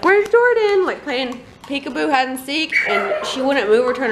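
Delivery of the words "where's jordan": high, laughing call; calling for child. Retold or imitated. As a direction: call out looking for her. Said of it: "I was like".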